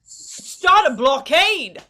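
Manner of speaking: surprised. Acted. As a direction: echo it back, amazed. Repeats the whispered idea aloud.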